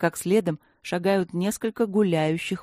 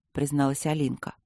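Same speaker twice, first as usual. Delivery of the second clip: ordinary delivery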